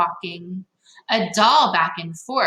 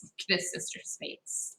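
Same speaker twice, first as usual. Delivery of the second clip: whisper; 15 dB quieter than usual. Real, not acted. Aside to children.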